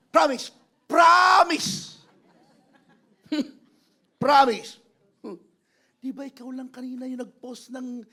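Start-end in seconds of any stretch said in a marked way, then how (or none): 0.1-4.8 loud, high, drawn-out vow; emphatic shouted promise. Acted. Preacher mimics Peter swearing his denial, emphatic shout. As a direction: swear it at full volume.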